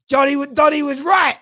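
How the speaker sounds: excited concession. Real, not acted. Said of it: "excited realisation".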